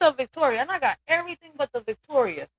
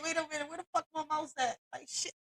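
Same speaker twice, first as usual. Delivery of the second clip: muttering to herself; 3 semitones higher, 12 dB quieter, faster than usual. Real, not acted.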